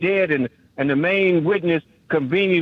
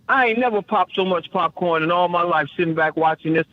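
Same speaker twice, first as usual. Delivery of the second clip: usual speaking voice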